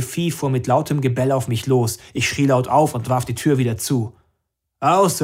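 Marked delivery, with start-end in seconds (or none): 4.8-5.2 sharp, loud, higher-pitched command; dog command. A narrator's reading. Max orders his barking dog back, loud command. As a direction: call the dog off with force.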